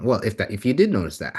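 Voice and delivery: normal talking voice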